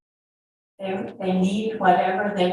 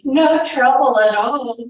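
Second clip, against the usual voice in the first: high, loud, sing-out brush-off; breezy brush-off; 7 semitones higher, 8 dB louder than usual. A narrator's reading. Soldier swinging the boy away from his mother, loud brush-off. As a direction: call it out over her worry.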